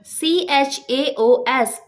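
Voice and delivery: letters spelled one by one, slowly; spelling out letters. Shown as a demonstration. Teacher spelling the word for students, slow and deliberate. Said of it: "हमारा first word है".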